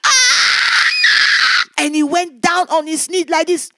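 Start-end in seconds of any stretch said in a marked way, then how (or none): 0.0-1.6 loud, high anguished yell; a defeated demon's shout; the intruder crying out as power hits him; cry of pain. Retold or imitated. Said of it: "he shouted".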